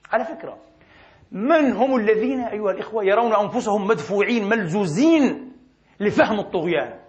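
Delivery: usual speaking voice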